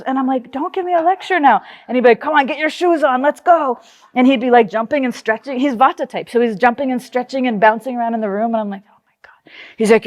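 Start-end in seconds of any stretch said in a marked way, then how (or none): 0.5-1.6 higher mock-whiny objection; retold protest. Daughter objecting to dad's pep talk, higher retold protest. Young self protesting to dad. 2.2-3.8 higher, lightly mimicked urging; pushy dad. Dad hurrying daughter out for a run, mimicked pushy pep. 8.9-9.5 hushed, unvoiced retold aside; whispered dismay. Daughter watching dad bounce around, dismayed whisper.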